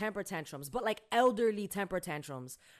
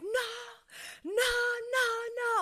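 mock distressed wail; panicked mom; 13 semitones higher than usual. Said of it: "she's like".

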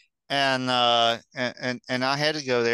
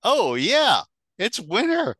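mock dismay; 14 semitones higher, 6 dB louder than usual. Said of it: "it was like".

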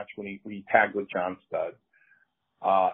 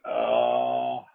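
a crying mock moan of pain; hammed-up wail after a chop; selling the pain. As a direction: cry out like the chop landed hard. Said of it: "So I'm selling it".

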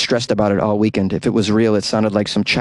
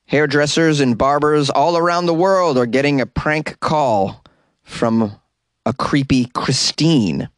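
usual speaking voice

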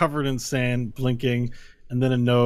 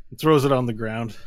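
ordinary talk, nothing marked

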